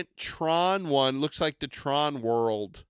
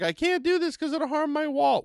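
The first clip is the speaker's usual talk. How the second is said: worried customer voice; 12 semitones higher than usual. Retold or imitated. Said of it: "you don't have to feel like".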